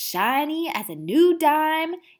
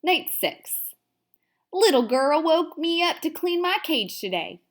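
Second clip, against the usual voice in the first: plain reading voice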